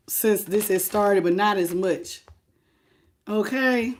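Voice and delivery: ordinary talk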